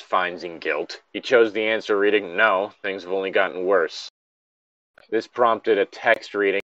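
reading a story aloud in an even, measured voice; story narration. Acted. Friend reads the game's story text aloud, as narration. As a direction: narrate the game text to a friend, steady and calm.

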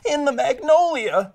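high, loud squealed cry. A narrator's reading. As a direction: squeal it out in alarm. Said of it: "squeals Farmer Pig".

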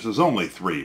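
ordinary talk, nothing marked

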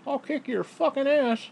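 high, slow, hammed-up threat; mock tough-guy threat. Quoting the man threatening the ex-husband, hammed-up. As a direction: ham up a macho threat in a squeaky voice. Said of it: "told the ex-husband that if he called".

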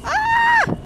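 high, loud startled shriek; terrified scream. Real, not acted. Streamer caught by the chaser, piercing scream. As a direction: scream as she grabs you.

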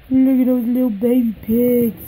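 loud, delighted cooing; delighted gushing. Real, not acted. Collector gushing over baby doll's toes, loud delight. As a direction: gush loudly over something tiny.

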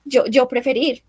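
ordinary talk, nothing marked

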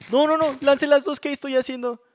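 high, loud panicked yelling; panicked shout. Real, not acted. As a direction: cry out in sudden panic. Panics after throwing both pearls. (grito de pánico)

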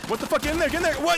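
high, loud shocked yell; excited shout. Real, not acted. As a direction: freak out at the play. Shocked gamer urging teammate on.

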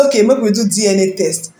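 ordinary talk

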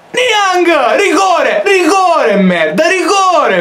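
high yelling; excited yelling. Real, not acted. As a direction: shout for the penalty. Demands a penalty.

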